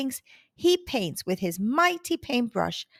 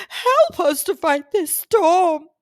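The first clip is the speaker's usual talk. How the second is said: shaky dragon voice; 7 semitones higher, 6 dB louder than usual. Acted. Dragon begs Morton to fight the storm, shaky high plea. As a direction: plead with the boy to save the island. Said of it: "answered in a shaky voice".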